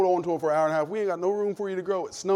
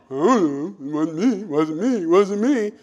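put-on denying kids; 4 dB louder than usual. Kids deny eating it.

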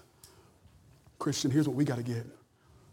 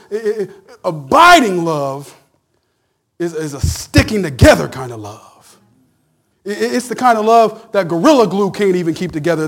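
loud shouted sermon climax; full-volume preaching peak; preaching at full force; 3 semitones higher, 16 dB louder than usual. Acted. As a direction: bring it home loud and hard.

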